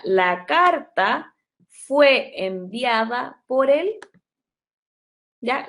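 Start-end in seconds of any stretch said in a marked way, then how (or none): 0.0-4.0 very slow, stretched out; slow dictation. Shown as a demonstration. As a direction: say it slowly as a model. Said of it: "yo lo que quiero expresar acá". (dictado lento y alargado)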